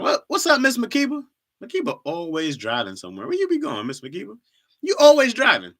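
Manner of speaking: calling out to a friend in mock surprise; teasing call-out. Calling out to viewer Makiba teasingly.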